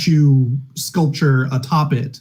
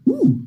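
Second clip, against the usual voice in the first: high, interested ooh; high bellhop ooh. Acted. Bellhop spots the coin on the note, high ooh. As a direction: coo it like a curious bellhop. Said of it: "the bellhop goes".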